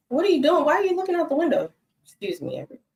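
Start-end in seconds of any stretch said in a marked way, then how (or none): 0.1-1.7 high sing-song voice for the dog; pet talk. Real, not acted. Owner coaxing her dog away from the window, high cooing pet talk.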